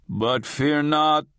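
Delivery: booming channeled spirit. A narrator's reading. Channeler speaking to the audience, slow solemn booming intoning. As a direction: intone like a channeled ancient being. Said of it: "Isus's booming bass".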